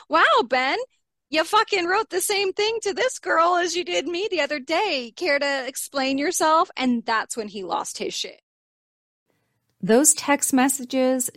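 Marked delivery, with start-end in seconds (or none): 0.1-6.7 higher, sarcastic wow, Ben; sarcastic confronting. Retold or imitated. Wife confronting husband over copied texts, sarcastic and higher. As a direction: needle him with mock surprise. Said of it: "reading these messages going".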